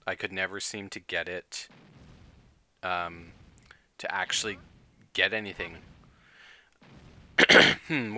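ordinary talk, nothing marked

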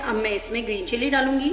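ordinary delivery

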